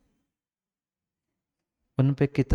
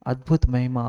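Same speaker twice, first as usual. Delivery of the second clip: ordinary delivery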